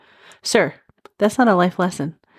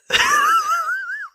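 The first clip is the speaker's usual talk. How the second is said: squealing laugh near crying; helpless high laugh that turns to tears. Real, not acted. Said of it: "I'm laughing so hard I'm crying".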